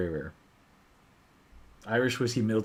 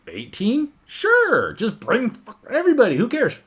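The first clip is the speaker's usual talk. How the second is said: much higher and louder, carefree yes; mock enthusiastic host; 12 semitones higher, 7 dB louder, slower than usual. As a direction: toss it off like nothing's too much. Quoting the generous distiller.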